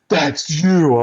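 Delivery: catching him out; surprised recognition. Retold or imitated.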